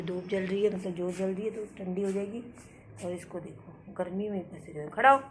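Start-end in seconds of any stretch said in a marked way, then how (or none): 4.9-5.2 loud, high calls to the dog; calling the dog. Real, not acted. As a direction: shout at the dog to get up and come in. Calling pet dog inside. (कुत्ते को ऊँची, ज़ोरदार आवाज़ में बुलाना)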